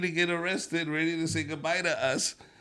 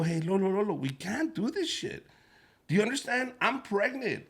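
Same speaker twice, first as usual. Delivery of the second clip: higher, worried wife pleading with husband; mom scolding husband; 7 semitones higher than usual. Retold or imitated. Pregnant wife scolding drunk husband, higher voice.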